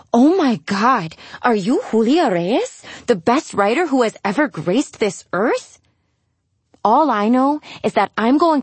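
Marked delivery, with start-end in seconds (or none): 0.1-5.8 starstruck fan. Acted. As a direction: gush like an awed admirer. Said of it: "people stop me on the street and ask".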